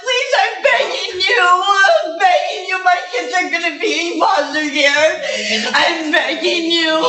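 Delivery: real crying while pleading with the judge; sobbing, begging. Real, not acted. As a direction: beg desperately through sobs. Defendant begs judge before jail.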